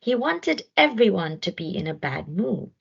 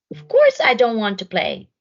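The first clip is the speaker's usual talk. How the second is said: louder, cross refusal; grumpy snort; 6 semitones higher, 5 dB louder than usual. Acted. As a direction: spit out the refusal like a sulky hippo. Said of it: "snorted Hudson".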